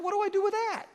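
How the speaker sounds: the pastor at a loss, lightly voiced; baffled. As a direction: act bewildered. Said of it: "You're like".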